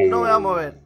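loud tense promise to freeze; scared vow. Real, not acted. Player promising the monster not to move, loud and scared. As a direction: hold still, terrified.

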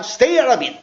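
short, linked "stay out of it"; quick pronunciation drill. Shown as a demonstration. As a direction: say it in one quick run. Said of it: "발음을 한번 연습할게요".

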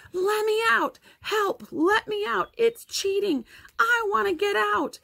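very high-pitched cries for help; desperate shouting. A narrator's reading. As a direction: holler to be let out from behind a door. Locked-in boy shouts for release, chanting.

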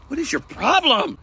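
high annoyed outburst; fed-up snap at the pet. Real, not acted. Pet scratching him again.

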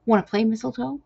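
high, loud playful invitation; eager. A narrator's reading. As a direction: ask it like a bouncy kitten. Eager kitten invites play.

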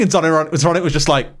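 ordinary delivery

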